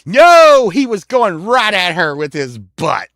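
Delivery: hammed-up youthful denial; boy's protest. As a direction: blurt it out like a defensive kid. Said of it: "And I was like".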